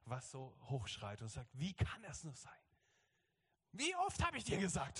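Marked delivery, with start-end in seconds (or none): 1.5-5.0 mock scolding child, pitch climbing high; little girl crying up. Believer scolds Jesus like a child, high-pitched protest. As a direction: imitate an indignant little girl. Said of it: "wie so ein kleines Mädchen, was so hochschreit".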